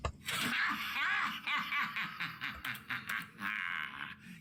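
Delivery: gleeful villain laugh; evil villain laugh. Acted. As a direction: cackle like a villain. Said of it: "That sounded like an evil villain laugh".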